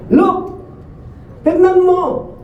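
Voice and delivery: high, sharp cry of the resentful son; the elder son yelling at his father, high and indignant; angry outburst. Acted. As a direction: throw the words back at the father in protest. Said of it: "Sabi niya".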